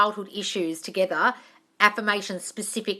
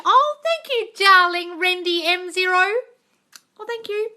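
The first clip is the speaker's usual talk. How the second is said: much higher and louder, delighted; gushing thanks; 11 semitones higher, 7 dB louder than usual. Real, not acted. Host thanking a viewer who praised her, gushing. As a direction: pour out the thanks.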